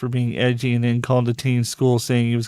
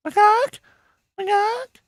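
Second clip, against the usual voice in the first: much higher, squeaky teasing call; mock squeaky taunt. Repeats the chicken taunt.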